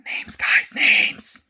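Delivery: fully whispered aside; exasperated whisper. Real, not acted. Vlogger to viewers after mixing up names, exasperated whisper.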